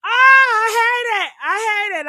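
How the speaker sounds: high, loud, tearful wail; crying shout. Real, not acted. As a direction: wail it, overcome with emotion. Overcome by the bride's vows.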